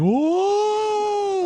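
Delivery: very high, held ghostly howl; drawn-out mock spook wail; long high "whoa" wail. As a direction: wail it out and hold the note. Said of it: "I disprove it by going".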